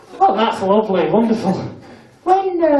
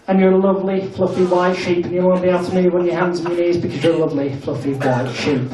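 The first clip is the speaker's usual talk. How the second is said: hypnotist's voice; 4 semitones lower than usual. Acted.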